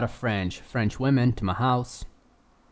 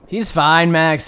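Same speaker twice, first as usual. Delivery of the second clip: louder, lifted mock protest; mock exasperation; 3 semitones higher, 7 dB louder, slower than usual. Brushing off Max's worry about the girl, mock exasperated. Mock defence of the rock injury.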